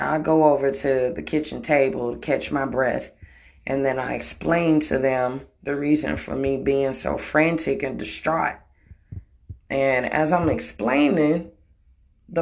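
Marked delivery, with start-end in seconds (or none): none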